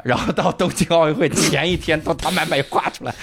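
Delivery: ordinary talk, nothing marked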